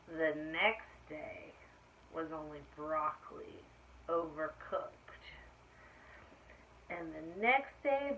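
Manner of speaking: slow, dramatic read-aloud turning ominous; story reading to children. A narrator's reading. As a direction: draw out the bad weather for the kids. Reading picture book aloud.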